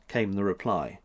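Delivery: usual speaking voice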